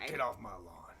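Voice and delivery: whispering get-off-my-lawn; hushed cranky old man; whispered old man grumble. Retold or imitated. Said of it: "I'll say it".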